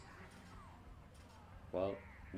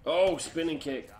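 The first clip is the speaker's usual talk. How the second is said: excited cheer; 7 semitones higher, 7 dB louder than usual. Real, not acted. Landing spinning kick.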